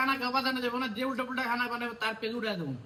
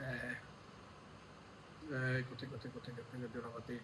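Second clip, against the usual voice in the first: low, much softer searching mutter; muttering to herself; 9 semitones lower, 12 dB quieter than usual. Real, not acted. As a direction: mutter while hunting on the phone.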